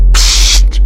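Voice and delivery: loud voiceless hushing hiss; hissed shush. A narrator's reading. Man silencing the frightened boy, hissed. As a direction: hush him with a forceful hiss. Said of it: "zasyčel mu do ucha".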